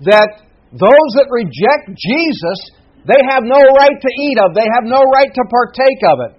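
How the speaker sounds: rising fervent sermon voice; preaching at full force. Acted. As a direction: drive it home from the pulpit.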